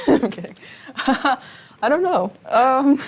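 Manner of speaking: laughing it off with giggles. Real, not acted. Giggling after a self joke.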